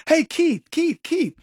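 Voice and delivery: high, cheery mock call of the wrong name; mock greeting. Imitating people who call him by the wrong name, high and cheery. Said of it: "if you".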